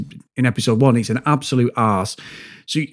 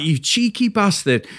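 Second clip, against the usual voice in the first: higher, drawn-out indignant insult; fuming retort; 5 semitones higher than usual. Retold or imitated. Employee answering the director's accusation, retold fuming retort. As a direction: re-enact an outraged comeback, lightly. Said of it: "I'm fuming".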